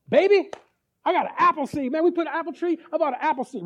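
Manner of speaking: bursting to show off his apple seed; husband bragging to his wife about a seed, gleeful; excited husband. Retold or imitated.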